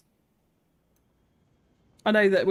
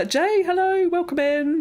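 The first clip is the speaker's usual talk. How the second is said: higher and louder hello; excited greeting; 11 semitones higher, 4 dB louder, slower than usual. Real, not acted. Greeting a viewer in chat, higher and louder. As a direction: call out a bright welcome.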